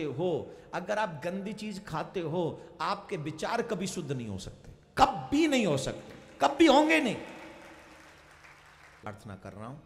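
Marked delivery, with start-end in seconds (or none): 5.0-7.2 rising to a loud, high cry; forceful insistence. Real, not acted. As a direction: hammer home never. (ज़ोर से चढ़ती आवाज़ में ज़िद)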